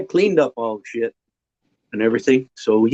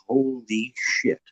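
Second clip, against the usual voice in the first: stunned; 7 dB quieter, much slower than usual. Retold or imitated. Friend stunned by the smell.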